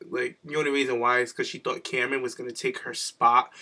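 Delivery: usual speaking voice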